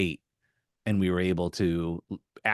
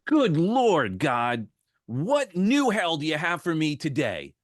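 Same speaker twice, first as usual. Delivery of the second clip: exasperated complaint; 6 semitones higher than usual. Retold or imitated. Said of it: "And then she goes".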